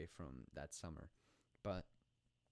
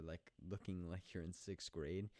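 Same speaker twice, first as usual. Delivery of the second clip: ordinary talk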